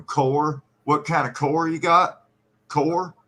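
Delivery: "core" for car, rounded OR vowel; regional car. Shown as a demonstration. Giving another example word, car pronounced "core". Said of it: "there's another one".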